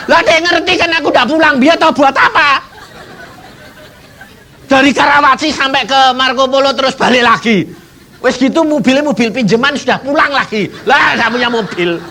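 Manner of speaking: high, loud, laughing retelling; excited telling. Real, not acted. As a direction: tell it as a joke on yourself. Preacher laughs telling of being outwitted. (bercerita dengan tertawa dan semangat)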